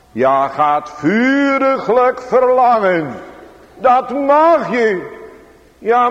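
high, loud pulpit exhortation; impassioned preaching. Acted. Preacher voicing a fearful believer's objection to seekers, impassioned preaching. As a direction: preach it at full force, pitch held high.

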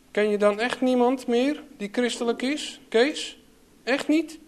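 put-on a sick young girl begging her brother, let down; teleurgesteld meisje. A narrator's reading. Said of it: "Teleurgesteld zegt Mieneke". (hoog, smekend meisjesstemmetje, teleurgesteld)

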